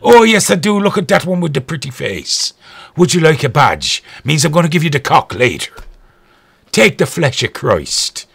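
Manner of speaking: hammed-up Irish brogue; Irish priest accent. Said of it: "I chose an Irish one".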